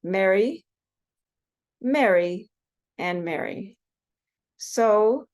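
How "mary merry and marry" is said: each "Mary" said identically as "merry", set apart by pauses; all pronounced alike. Shown as a demonstration. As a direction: list MARY three times with the same 'eh' vowel. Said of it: "are all pronounced the same".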